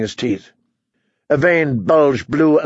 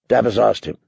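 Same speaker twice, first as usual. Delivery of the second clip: usual speaking voice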